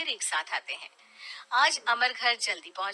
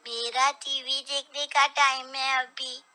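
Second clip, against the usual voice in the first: put-on a cross little boy wanting his TV; sulky child; 5 semitones higher, 5 dB louder than usual. Son sulking at father over TV time, childish.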